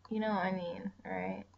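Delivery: normal talking voice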